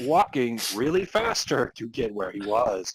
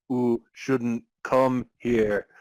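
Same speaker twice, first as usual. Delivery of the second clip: spooky slow warning; much slower than usual. Acted. Spooky road sign warning.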